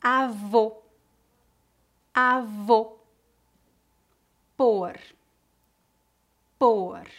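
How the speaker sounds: Avô and Por with a closed /o/. Shown as a demonstration. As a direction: round the lips tight on the O. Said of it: "Pronúncia fechada".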